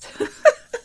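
high burst of laughter; loud, high cackling laugh. Real, not acted. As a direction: crack up at your own slip. Laughs at her own silly question.

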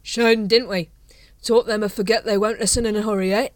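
put-on slurred, loud, rambling drunk captain; drunk Vimes. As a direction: play a tipsy officer waxing on.

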